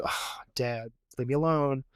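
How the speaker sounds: sighed, low and soft exasperation; worn-down son. Retold or imitated. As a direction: imitate a kid quietly fed up with Dad. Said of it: "it was wearing on him".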